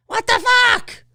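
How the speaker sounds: high-pitched indignant outburst; mock outrage. Imagining fans' reaction to an ignored cliffhanger, outraged. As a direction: shout it like a cheated fan.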